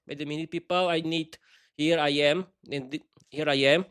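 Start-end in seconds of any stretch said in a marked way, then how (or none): none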